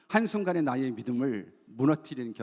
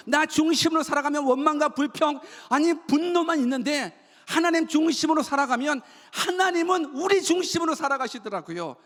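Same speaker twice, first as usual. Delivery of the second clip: high, driving sermon delivery; impassioned preaching; 10 semitones higher, 3 dB louder than usual. Real, not acted. Pastor warning the congregation about self-centred living, impassioned preaching. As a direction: preach hard, stretching out the key words.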